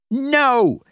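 high, loud outburst of refusal; angry shout. A narrator's reading. Guest answering the desk clerk, angry shout. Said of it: "He was shouting at the man".

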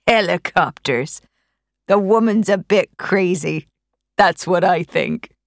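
lightly voiced neighbour waving it away; scoffing old countryman.

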